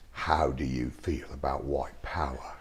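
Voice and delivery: imitating the director's low whispered question; excited whisper. Retelling the director whispering the audition question. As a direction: breathe it out, low and hushed. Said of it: "he got real excited, and he goes".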